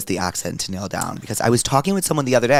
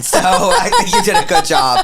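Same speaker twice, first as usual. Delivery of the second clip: laughing; 7 semitones higher, 7 dB louder than usual. Real, not acted. Laughing compliment.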